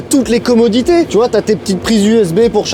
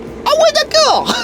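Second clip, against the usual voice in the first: étonné; slower than usual. Retold or imitated. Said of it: "j'étais en mode".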